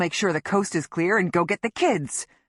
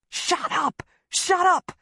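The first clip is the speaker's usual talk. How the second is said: frantic yell; 11 semitones higher, slower than usual. A narrator's reading. Husband pinning wife to a tree, frantic yelling.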